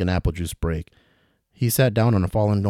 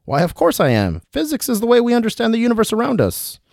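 put-on dangling old traveler; 4 dB louder than usual. William calling to Ricky from upside down, high and fussy. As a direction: call down politely from the rope.

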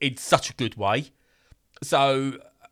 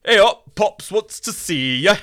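put-on thick Yorkshire accent; 8 semitones higher, 8 dB louder than usual. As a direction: boom it out in broad Yorkshire. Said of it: "in a thick Yorkshire accent".

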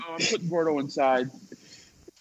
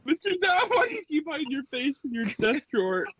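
higher, whiny classroom heckle; mocking student voice; 8 semitones higher than usual. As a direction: needle the teacher like a kid. Students mocking teacher questions.

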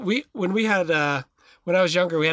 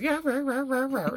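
higher, imitated little dog yapping; mock yapping barks; yappy dog bark; 8 semitones higher, 3 dB quieter than usual. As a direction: yap like an annoying small dog. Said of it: "you hear that yappy dog".

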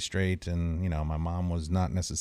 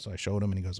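ordinary delivery

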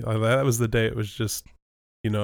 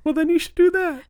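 high squeaky voice; 6 dB louder than usual. Pretend. Mimicking Mickey-like squeak.

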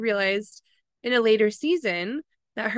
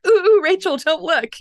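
urgent warning; 8 semitones higher, 8 dB louder than usual. Retold or imitated. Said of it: "she's like".

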